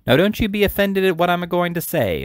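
high, coaxing sweet voice; captivating sweetness. A narrator's reading. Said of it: "with captivating sweetness".

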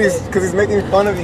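normal talking voice

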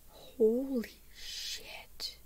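very slow, stunned whisper; breathed in shock. A narrator's reading. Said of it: "Emma breathed".

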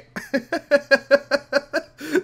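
long, high, gleeful giggle; real overjoyed laughter; elated laugh. Real, not acted. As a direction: laugh on and on in delight. Found the promised land.

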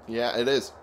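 usual speaking voice

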